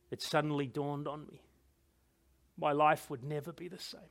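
low, soft, with heavy pauses; desolate realisation. A narrator's reading. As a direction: let the loss sink in quietly. King realises his life is ruined.

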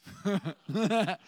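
short real chuckle; amused laugh. Real, not acted. Preacher amused at our fallen nature, short laugh. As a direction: laugh at your own joke.

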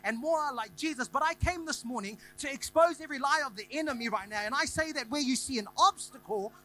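loud, high, driving sermon cadence; full-force preaching. Acted. Pastor exhorting the church at full force. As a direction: preach it hard to the room.

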